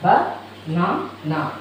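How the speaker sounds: "Ba, na, na" in three separated beats; syllable by syllable. Shown as a demonstration. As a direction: break "Ba, na, na" apart with pauses. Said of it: "three syllables".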